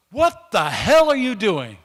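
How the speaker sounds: a sergeant bellowing at a prisoner; angry shout. Retold or imitated.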